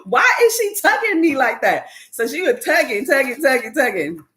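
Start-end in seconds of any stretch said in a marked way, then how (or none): 0.0-1.9 high, incredulous disbelief with a laugh; baffled inner outburst. Retold or imitated. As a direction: squeal it out in shocked disbelief at the dentist. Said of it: "I'm like".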